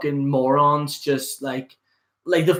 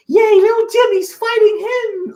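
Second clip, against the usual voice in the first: high, loud mocking fanboy squeal; mock excited fan; 7 dB louder than usual. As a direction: ham up an overexcited kid cheering toy fights. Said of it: "those people are like".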